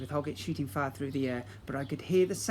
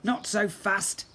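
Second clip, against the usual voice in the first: slow, loud warning; 6 semitones higher, 5 dB louder, slower than usual. Acted. Calamitous confronting the prince.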